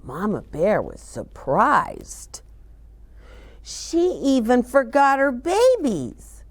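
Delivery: lively storybook reading for little ones; reading to children. A narrator's reading. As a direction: read it like a children's librarian at story time. Reads picture book to children.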